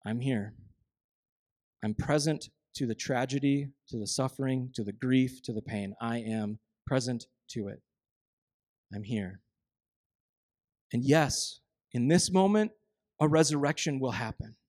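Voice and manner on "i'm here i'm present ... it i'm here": soft, low, quiet reassurance; tender and present. Acted. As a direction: speak as if sitting with a mourner. Jesus voiced present in grief.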